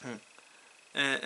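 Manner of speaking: ordinary delivery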